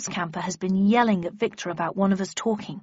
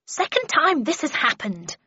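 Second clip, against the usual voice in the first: higher, indignant outburst; defiant yell; 6 semitones higher than usual. Acted. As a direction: fling it out in defiance. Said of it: "she yelled defiantly".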